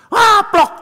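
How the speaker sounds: high loud wail cut off by a spoken smack; hammed-up scream and slap noise; mock scream, slapped. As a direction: imitate a child's shriek ending in a slap. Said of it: "makin teriak makin digaplok".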